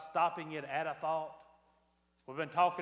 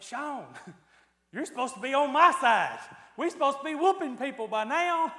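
loud and high with a laugh; playfully outraged at his buddy; mock betrayed protest; 9 semitones higher, 11 dB louder than usual. [name] complaining to Sean he should be on his side, mock outrage. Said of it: "And I went".

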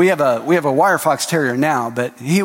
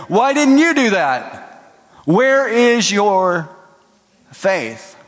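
exasperated rebuke; 6 semitones higher, 3 dB louder, much slower than usual. Retold or imitated. God rebuking him.

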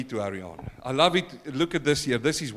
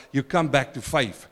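ordinary talk

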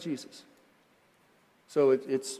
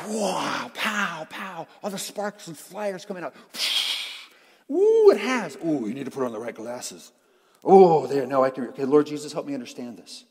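put-on loud, exclaiming kid amazed by a book; hammed-up childlike awe; nine-year-old's wonder; 8 dB louder than usual. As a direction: marvel like an excited child, with sound effects. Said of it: "our inner nine-year-old boy".

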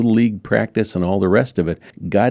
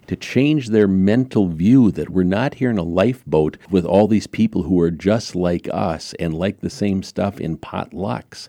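ordinary talk

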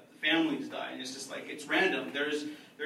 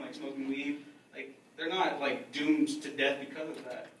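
usual speaking voice

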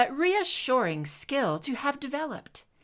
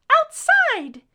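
put-on very high, loud child's shriek; 12 dB louder than usual. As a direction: shriek it like an excited little boy. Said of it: "he shrieked".

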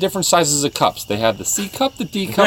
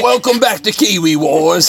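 Alex Jones Down Under; 3 semitones higher than usual. Acted. Host announcing Kiwi Wars, hammed-up ranting host. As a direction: bellow a drawn-out radio show intro. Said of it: "sounds more British than Kiwi".

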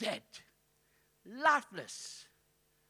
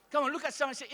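ordinary talk

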